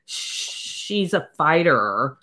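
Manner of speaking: slow, long drawn-out "Sh" opening "She's"; snake-like S's. Shown as a demonstration. Snake alien talking about Gemma, long "Sh" on "She's". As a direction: stretch the start of "She's" like a snake. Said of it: "again with the S's".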